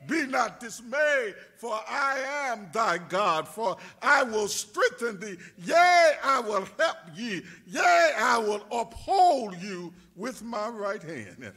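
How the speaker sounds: loud, slow, declaiming scripture; full-force preaching. Acted. Pastor proclaiming Isaiah to the congregation, loud declaiming. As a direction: thunder out the verse to the church.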